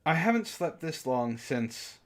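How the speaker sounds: normal talking voice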